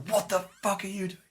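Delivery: high-pitched indignant demand; outraged challenge. Retold or imitated. Raging fighter to the mage coming up chanting, outraged.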